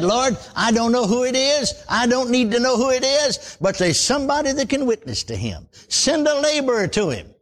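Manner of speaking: lifted, earnest prayer to the Lord; a touch of pleading prayer; retold prayer. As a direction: plead for a laborer, reaching up. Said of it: "I said".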